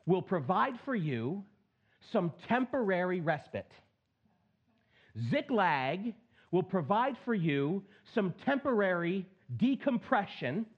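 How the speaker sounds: normal talking voice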